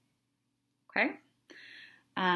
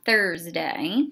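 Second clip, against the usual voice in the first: ordinary talk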